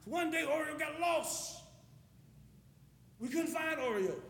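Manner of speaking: ordinary talk, nothing marked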